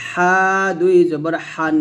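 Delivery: "হা" and "হান" squeezed from mid-throat; throat-pressed "হা". Shown as a demonstration. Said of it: "এ হাটা গলার মাঝে চাপ দিয়ে".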